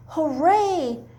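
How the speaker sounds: high happy cheer. Acted. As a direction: cheer like a crowd of chicks. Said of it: "they cried".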